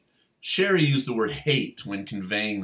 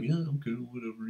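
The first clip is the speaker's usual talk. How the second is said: softer, bored and offhand; dismissive shrug; 2 semitones higher, 7 dB quieter than usual. Retold or imitated. Wes answering Mariko's invitation, softly and offhand. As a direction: brush her off like it costs you effort. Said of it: "so dismissive and just so laconic".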